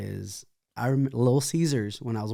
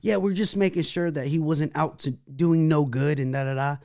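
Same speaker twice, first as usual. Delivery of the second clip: high, louder mock sweet cop voice; fake-nice cops; 6 semitones higher, 4 dB louder than usual. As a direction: ham up the police suddenly being friendly. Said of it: "being all nice to him".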